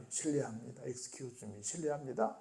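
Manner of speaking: 외국에서 습관처럼 부드럽게 하는 인사; polite traveler. Retold or imitated. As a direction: murmur them like habitual courtesies. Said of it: "이걸 막 입에 달고 살아요".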